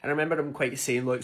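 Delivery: ordinary delivery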